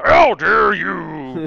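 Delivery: very loud, drawn-out mock outrage; Alex Jones. Co-host exaggerating the impression just heard, loud slow bellow. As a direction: bellow it slowly as a hammed-up ranter. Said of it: "a good Alex Jones impression".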